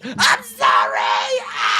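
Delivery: hammed-up shout of sorrow; mock wail. Triple H crying out to the fans, mock wail. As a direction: cry out in mock despair. Mock cry after laughing.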